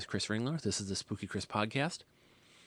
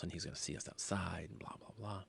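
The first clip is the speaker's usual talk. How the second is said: ordinary delivery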